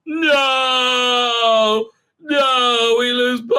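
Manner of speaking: loud, high hammed-up wail; mock wailing. Mocking the crew losing Booker, loud mock wail. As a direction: overplay the grief as a joke. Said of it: "He goes".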